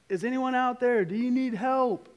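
high, loud call into the dark; retold yell. Dad calls out to someone in the woods, retold yell. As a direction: call out loudly, as if to someone far off. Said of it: "I yelled out".

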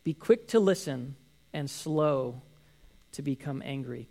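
drawn-out scripture quote; slow reading. Shown as a demonstration. Pastor quoting James slowly to the congregation. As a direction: give each word weight, slowly. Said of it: "and he says".

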